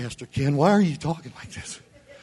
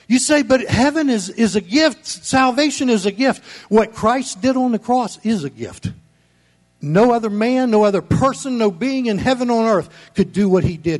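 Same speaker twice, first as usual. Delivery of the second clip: loud, high, fired-up sermon delivery; full-force preaching; 6 semitones higher, 6 dB louder than usual. Acted. Pastor thundering at his church, loud preaching. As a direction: preach it hard to the congregation.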